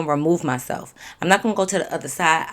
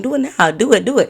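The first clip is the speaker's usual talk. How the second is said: taunting dare; 6 semitones higher, 7 dB louder, faster than usual. Retold or imitated. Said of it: "walking up to her like".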